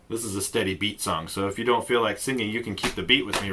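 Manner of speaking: ordinary talk, nothing marked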